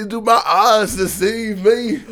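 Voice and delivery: high, slow, loud mock outrage; loud imitation. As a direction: bellow it like a furious man, drawn out. Mimicking angry man from video.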